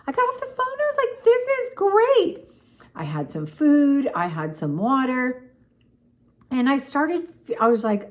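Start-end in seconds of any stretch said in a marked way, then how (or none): 0.1-2.5 excited. Retold or imitated. Excited retelling.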